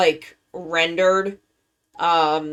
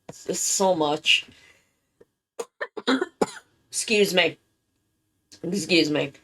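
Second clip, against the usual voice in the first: usual speaking voice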